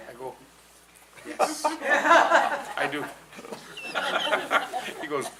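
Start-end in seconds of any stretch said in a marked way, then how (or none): 1.3-3.0 high, slow, loud laughing yes; much higher laughing reply; laughing eager yes. Retold or imitated. Eager teen answer, laughing. 3.1-5.0 airy laugh up high; breathless laughter. Real, not acted. Laughing at own story.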